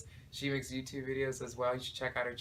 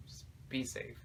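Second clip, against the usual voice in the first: whispered "Just be safe", softer and breathed out; 6 dB quieter than usual. Real, not acted. Vlogger whispering a last warning to viewers about vacations.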